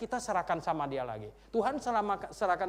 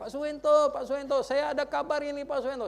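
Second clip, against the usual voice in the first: high, loud calling out from afar; imitating someone hollering for the pastor; imitated excited shouting; 10 semitones higher, 6 dB louder than usual. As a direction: shout the news like an eager student at the gate. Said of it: "dia teriak-teriak".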